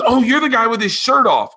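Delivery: high, excited woman's voice; a fan suddenly recognizing him, high and excited; excited recognition. Retold or imitated. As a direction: squeal it out like a thrilled fan. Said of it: "she goes".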